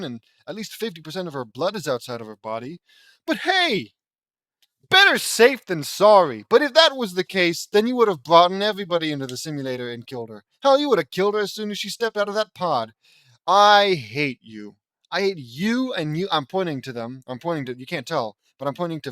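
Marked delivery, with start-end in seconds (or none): 3.3-12.9 loud, fast, high-pitched rant at the story; exasperated rant. Real, not acted. Ranting at the story's logic. 13.5-16.3 slow, loud, half-joking I hate you; mock hate. Mock fury at the characters.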